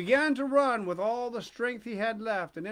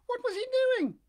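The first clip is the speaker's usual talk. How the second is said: very high, loud puppet question; eager Pinocchio; 5 dB louder than usual. A narrator's reading. Puppet questioning the pigeon about papa, high eager voice.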